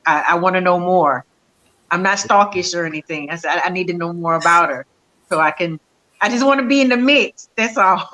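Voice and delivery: usual speaking voice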